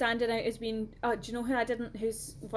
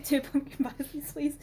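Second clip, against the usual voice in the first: put-on Scottish accent; 4 semitones higher than usual. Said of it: "yeah, she's got that accent".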